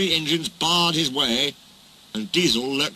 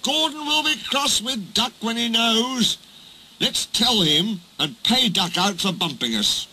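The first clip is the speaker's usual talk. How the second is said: high-pitched mischievous character voice; gleeful trucks; 9 semitones higher than usual. Acted. Trucks plan to pay Duck out, high and gleeful. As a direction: plot revenge with cheeky glee.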